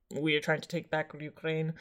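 half-hearted Russian accent voicing the Russian state; terrible Russian accent. Podcaster voicing Russia on Ukraine, in a bad Russian accent. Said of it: "that was a terrible Russian accent".

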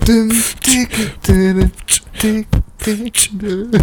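vocal beatbox sounds; beatboxing with the mouth. Pretend. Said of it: "du musst den Beat machen".

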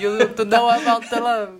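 put-on hammy Italian-accented English. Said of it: "sotaque horroroso da [name]". (sotaque italiano caricato)

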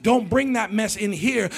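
ordinary talk, nothing marked

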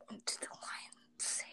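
voiceless whisper to herself. Real, not acted. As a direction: whisper the question back.

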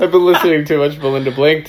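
fast, laughing. Real, not acted. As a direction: giggle through it. Laughing after a joke.

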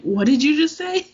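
high, loud what did you just say; stunned question. Pretend. Fan stopping mid-battle to question Hank, loud and incredulous. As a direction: stop everything in disbelief. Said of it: "to be like".

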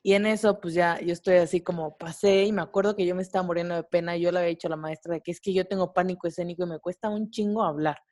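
normal talking voice

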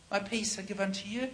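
very soft, unforced blessing; gentle ministering. Shown as a demonstration. Preacher showing how he ministers to children, softly. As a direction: speak peace softly over them. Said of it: "This is how I minister".

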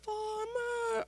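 high, slow, breathy-sounding reverence played for laughs; a high, slow, hammed-up gasp of awe; mock awed greeting. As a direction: gasp in awe as if a saint walked in. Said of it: "and it's like".

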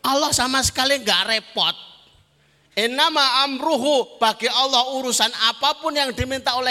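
high-energy religious oratory; forceful preaching. Real, not acted. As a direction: preach it out to the whole crowd.

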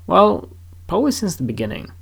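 ordinary delivery

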